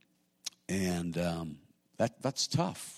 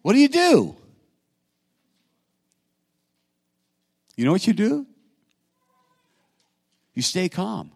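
much higher and louder, calling out to the congregation; preaching at full force; 13 semitones higher, 11 dB louder than usual. Acted. Pastor answers his own question, booming preaching cry. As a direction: ring it out from the pulpit. Preacher drives the point home.

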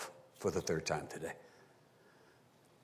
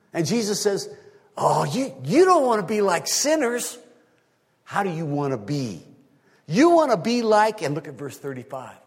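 high, loud preacher's voice; full-force preaching; 13 semitones higher, 10 dB louder than usual. Real, not acted. Preacher showing the congregation everyone blames the other side, impassioned preaching. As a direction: drive each example home, high and loud.

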